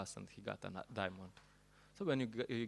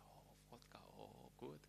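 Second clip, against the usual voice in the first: breathy whispered delight over the drink; savoring whisper; 3 semitones higher, 20 dB quieter than usual. Retold or imitated. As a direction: whisper it like tasting something precious. Man savouring his vodka.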